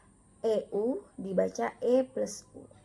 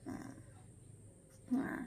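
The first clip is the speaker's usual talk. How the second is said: usual speaking voice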